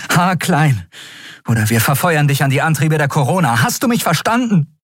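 hoch und drängend, am Ende gesteigert; grausame Drohung. A narrator's reading. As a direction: droh dem Gefangenen. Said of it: "hasste sich selbst für ihre Grausamkeit". (drohend verhört)

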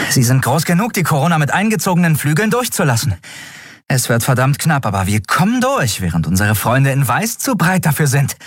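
fast, high pilot voice, sure of herself; confident pilot. Acted. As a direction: rattle it off like a daring pilot. (schnelle, hohe Pilotinnenstimme, selbstsicher)

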